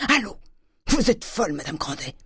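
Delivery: put-on high outraged old man; angry retort. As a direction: scold her as mad. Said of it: "cria le bonhomme". (indignation du vieux Grandet)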